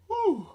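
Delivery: long high audible yawn. Real, not acted. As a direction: let out a big tired yawn. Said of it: "so I'm yawning".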